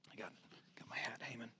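unvoiced whisper about the hat; whispered aside. Real, not acted. Pastor whispers a joke to the congregation, hushed. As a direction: breathe it as a private aside.

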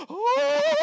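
sharp high gasp of alarm; a startled high cry. Retold or imitated. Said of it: "I'm, like, freaking out".

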